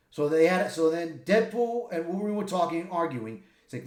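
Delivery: ordinary delivery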